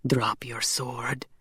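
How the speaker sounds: low, slow hissing whisper; hissed order. A narrator's reading. Casimir orders his enemy to disarm, hissed. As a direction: hiss it under your breath, drawn out. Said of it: "Casimir hissed".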